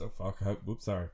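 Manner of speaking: ordinary talk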